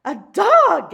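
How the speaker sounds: high, loud shocked gasp. Acted. Manager gasping at the dog, shocked gasp. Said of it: "gasped the manager".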